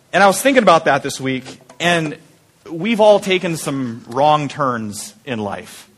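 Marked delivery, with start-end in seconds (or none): none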